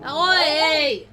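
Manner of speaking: sudden loud shout to stop the pets. Real, not acted. Streamer yelling at her noisy pets, loud shout. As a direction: bark a sharp warning.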